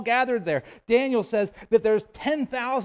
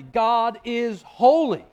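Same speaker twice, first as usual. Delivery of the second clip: slow, louder weight on "holy"; preacher driving home "holy" slow and strong; emphatic declaration; 3 semitones higher, 6 dB louder, much slower than usual. Real, not acted. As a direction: declare it slowly, stretching and punching "holy". Climax of emphatic teaching point.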